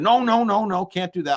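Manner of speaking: high, rapid-fire refusal; David Lynch. Retold or imitated. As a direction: wave the request away in a high director voice. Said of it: "And he said".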